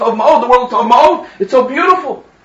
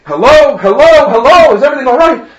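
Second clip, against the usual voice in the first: loud, higher, worried hellos; yelling into phone; 7 semitones higher, 7 dB louder than usual. Acted. Groom fearing a medical emergency on the line, yelling. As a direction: call out urgently to a silent line. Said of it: "I'm yelling, I'm shouting".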